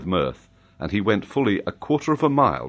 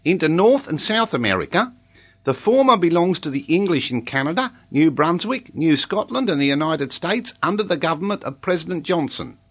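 high-pitched schoolboy rattling off his lesson; earnest young pupil, high-voiced; child pupil reciting; 11 semitones higher than usual.

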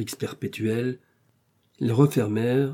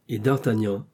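plain reading voice

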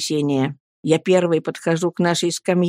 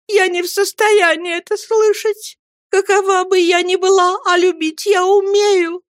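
high-pitched, piteous pleading; distressed wife begging to be believed; plaintive entreaty; 10 semitones higher than usual. A narrator's reading. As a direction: implore him in a lifted, lamenting voice. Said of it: "очень жалобным голосом взмолилась матушка".

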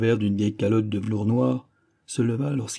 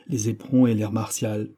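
ordinary delivery

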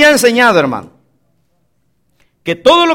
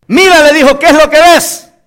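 higher, louder prophet's demand; urgent questioning; 5 semitones higher, 4 dB louder than usual. Acted. Elías asks his servant what he sees, urgent questioning. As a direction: call it out to the servant.

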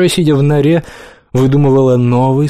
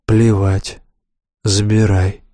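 sighing; 5 semitones lower, slower than usual. Acted. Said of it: "вздохнул кот".

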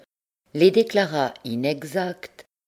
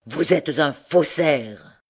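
usual speaking voice

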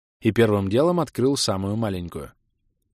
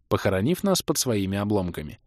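usual speaking voice

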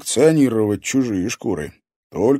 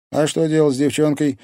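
ordinary delivery, nothing marked

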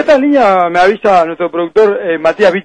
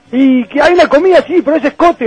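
higher-pitched imitation of veteran players; 6 semitones higher than usual. Host imitating veterans telling the new player to chip in, teasing imitation. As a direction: put on the old hands' voice, pitched up. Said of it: "los veteranos te decían".

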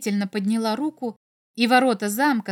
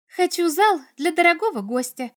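put-on higher, lively girlish cry; joyful call; 7 semitones higher, 4 dB louder than usual. A narrator's reading. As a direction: call it out with delight, not at full shout. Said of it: "крикнула она".

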